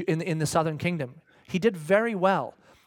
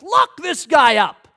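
loud, slow shouted command; furious king ordering arrest; angry royal order; 7 semitones higher, 9 dB louder, slower than usual. Acted. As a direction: bark it at the guards. King orders prophet jailed.

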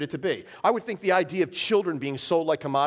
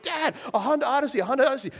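put-on higher, eager kid voice, rattling it off; excited kids; 9 semitones higher, faster than usual. Kids in the mall lot, calling to dad, excited. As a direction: mimic the kids pointing again.

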